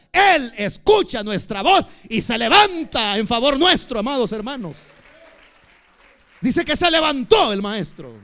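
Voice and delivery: loud, rising sermon cry; fiery preaching. Acted. Pastor exhorting his congregation, in a loud fiery preaching voice. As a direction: preach it out to the whole church.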